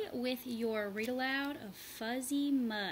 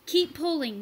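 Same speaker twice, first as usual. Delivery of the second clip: loud, higher urging; 6 semitones higher, 10 dB louder than usual. A narrator's reading. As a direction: strain and urge. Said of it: "Marshall urged".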